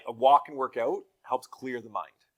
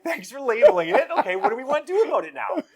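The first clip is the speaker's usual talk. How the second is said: much higher and louder, upbeat; cheery parent; 13 semitones higher, 11 dB louder than usual. Retold or imitated. Dad thanking daughter for naming it, high and loud. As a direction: brightly move on like a coaching dad. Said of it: "It's like".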